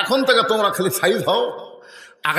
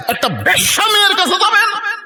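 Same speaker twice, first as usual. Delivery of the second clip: outraged shout. Retold or imitated. Disciples confront their holy master, high outraged shout. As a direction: shout it as a scandalised challenge.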